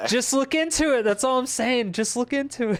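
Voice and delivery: high-pitched, playful insistence; mock conspiracy pushing. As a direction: egg them on in a high voice. Playing a conspiracy believer.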